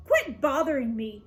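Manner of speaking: ordinary delivery, nothing marked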